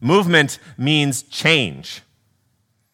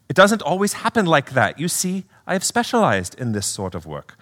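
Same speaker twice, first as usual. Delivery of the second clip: put-on British accent; 3 semitones higher than usual. Spirit explaining to his sister, British accent. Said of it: "do a little accent work".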